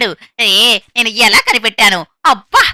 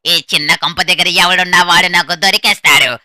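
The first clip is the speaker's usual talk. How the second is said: ordinary talk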